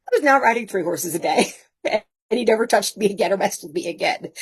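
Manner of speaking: usual speaking voice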